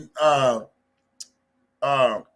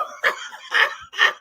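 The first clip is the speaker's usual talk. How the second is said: wheezing laugh. Real, not acted. Laughing at the clip.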